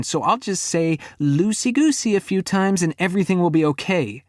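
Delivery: mock his advice furiously; sarcastic fury. A narrator's reading. Girlfriend sarcastically mocking his calm down, very high and loud. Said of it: "Her tone is detached".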